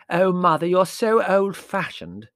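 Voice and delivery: high, loud, drawn-out protest; slow, emphatic complaint; exasperated daughter. Acted. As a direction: drag it out in youthful exasperation. Daughter protests to her mother.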